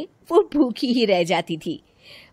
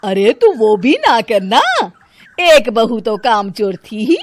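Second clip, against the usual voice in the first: put-on loud, scornful older woman; grumbling mother-in-law; 3 semitones higher, 9 dB louder than usual. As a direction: scoff at the lazy daughters-in-law.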